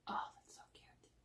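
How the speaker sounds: hushed whisper of delight. Real, not acted. Whispering delight at a cute item in the box. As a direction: whisper it like a secret.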